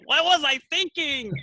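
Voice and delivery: high hammed-up disbelief; mock dismay. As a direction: throw up your hands at your own mistake. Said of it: "I go".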